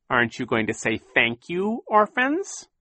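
high, mocking villain voice rising on orphans; sneering villain. A narrator's reading. Captain Sham taunting the orphans, high and mocking. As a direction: taunt them like a smug pirate.

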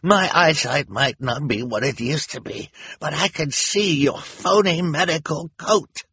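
high, loud indignant character voice; shouting. A narrator's reading. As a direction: call out the accusation. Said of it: "shouted Hal".

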